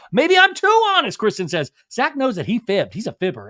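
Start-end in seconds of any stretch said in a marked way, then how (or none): 0.1-1.2 loud, very high imitation of self-justifying protest; hammed-up indignant declaration; mock outburst. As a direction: belt it out high, making fun of the claim. Mocking Kristen's self-justifying claim.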